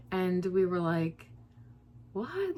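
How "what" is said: high, sudden disbelieving what; stunned what. Retold or imitated. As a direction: blurt it out in shock. Stunned reply to stranger.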